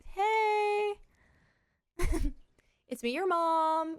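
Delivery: high, slow sing-song greeting with a laugh; sweet mom voice. Retold or imitated. Mom reassuring her groggy toddler, high sing-song coo. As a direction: coo it gently to a just-woken toddler.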